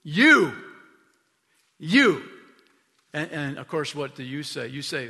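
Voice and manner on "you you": high, loud one-word jab at the listener; single the listener out, loudly, word by word; pointed callout. Acted. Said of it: "he points it towards you".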